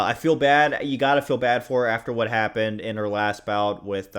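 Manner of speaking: normal talking voice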